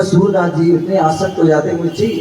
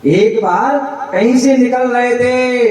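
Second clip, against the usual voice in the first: "एक बार कहीं से निकल रहे थे" said again, higher; raised retelling; 7 semitones higher than usual. Shown as a demonstration. Preacher repeats the opening to the audience, pitched higher. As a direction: lift the line higher for emphasis. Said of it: "repeated line".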